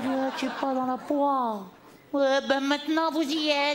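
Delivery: triumphant retort. Retold or imitated.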